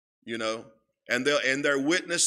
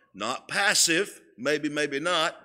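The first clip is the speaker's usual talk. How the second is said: ordinary talk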